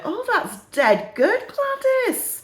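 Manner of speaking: put-on high, slow northern old lady; gushing in put-on northern English; northern English accent. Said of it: "have a go at the accents".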